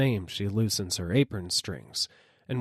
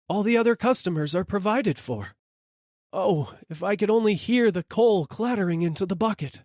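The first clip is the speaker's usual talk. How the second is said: begging rider; 11 semitones higher, 3 dB louder than usual. A narrator's reading. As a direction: beg for just a shovelful. Rider begs.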